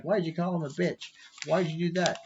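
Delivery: high, prissy scolding voice; mock scolding MPs. Imagined MPs scolding him, in a high mock voice. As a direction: mimic the offended parliament members. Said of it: "they're like".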